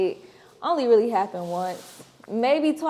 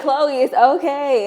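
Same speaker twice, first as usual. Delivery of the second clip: slow, gentle "it's okay" through a laugh; tender comfort, slowed down; reassuring sister; 6 semitones higher, 8 dB louder, slower than usual. Retold or imitated. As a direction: soothe your little sister. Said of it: "I'm like".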